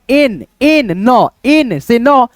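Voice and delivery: एन and नौ stretched slowly to show the match; sounding out letters. Shown as a demonstration. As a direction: drag out एन, एन, नौ like a teacher. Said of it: "एन लिख देंगे". (एन और नौ को धीरे खींचकर मिलान दिखाओ)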